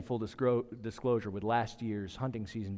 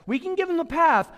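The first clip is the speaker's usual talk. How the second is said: preaching climax; 9 dB louder than usual. Acted.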